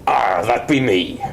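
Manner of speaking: slow, drawn-out pirate drawl; play-acted pirate on the phone; pirate role-play. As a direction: play a pirate owning up, unhurried. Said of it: "to do pirate lingo".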